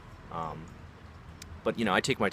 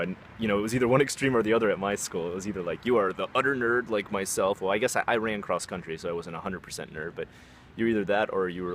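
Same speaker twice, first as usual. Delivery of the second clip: ordinary delivery, nothing marked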